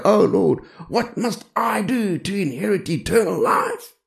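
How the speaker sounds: high, hammed-up pleading of a drama queen; dramatic plea. Rich young ruler throwing himself down before the Lord, hammed-up pleading. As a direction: wail it like a melodramatic supplicant. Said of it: "the man is a drama queen".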